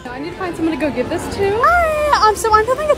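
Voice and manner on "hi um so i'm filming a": much higher, eager hi; bright greeting. Real, not acted. Vlogger greeting a random stranger, high and eager. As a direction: pop up friendly to a stranger.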